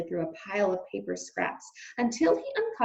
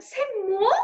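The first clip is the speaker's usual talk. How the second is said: high rising French "C'est moi"; French "C'est moi" repeated; French accent; 11 semitones higher, 4 dB louder than usual. Acted. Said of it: "said Antoinette".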